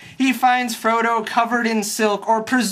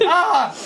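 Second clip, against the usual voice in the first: a quick, hammed-up "Ah!" with a laugh; light, laughing mock battle cry; mock stab yell; 8 semitones higher than usual. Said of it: "and is like".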